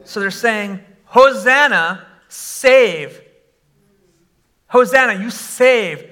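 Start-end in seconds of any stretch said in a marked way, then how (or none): none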